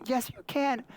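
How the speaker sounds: high, playful yes you can; high mock insistence. Dad insisting to his daughter, high and playful. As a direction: insist back lightly. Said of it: "She said".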